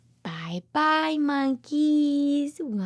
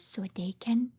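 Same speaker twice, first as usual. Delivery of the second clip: whispered; 5 semitones lower, 5 dB quieter than usual. Acted. Reading to a toddler.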